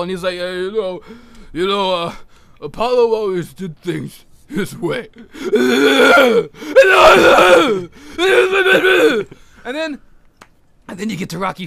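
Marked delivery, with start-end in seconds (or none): 0.3-5.1 halting, slurred boxer impression; Rocky. Rocky speaks at Apollo's funeral, halting put-on voice. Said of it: "he goes to Apollo's funeral". 5.1-9.3 loud, high, drawn-out mock bawl; hammed-up wailing. Rocky breaks down at Apollo's funeral, mock wailing.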